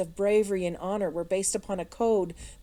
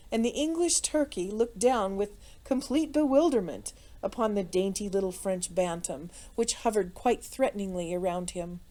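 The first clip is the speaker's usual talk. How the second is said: ordinary delivery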